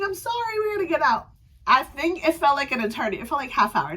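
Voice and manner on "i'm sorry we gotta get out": squeaky apology. Retold or imitated. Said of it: "Going through the crowd saying".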